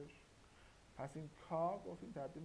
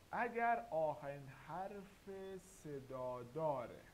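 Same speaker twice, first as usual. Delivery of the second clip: slow teaching emphasis; slower than usual. Shown as a demonstration. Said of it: "teacher stressing the rule".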